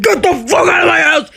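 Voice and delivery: high, loud screaming tirade; mother's furious shout. Retold or imitated. Mom kicking daughter out over a birthday fight, furious shouting. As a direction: yell it like a mom throwing someone out. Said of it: "kicking me out of the house and said".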